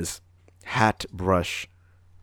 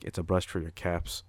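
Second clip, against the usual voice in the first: softer, flat matter-of-fact reply; deadpan monotone; 3 semitones lower, 8 dB quieter than usual. Retold or imitated. At work, answering a customer in ShamWow's deadpan. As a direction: state the obvious with no expression. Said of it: "ShamWow kinda rubbed off on me".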